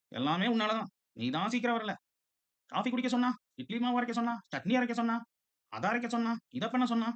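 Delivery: high-pitched household nagging; nagging family scolding. Acted. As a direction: reel off complaints in a high voice. Acted family quarrel over missed train.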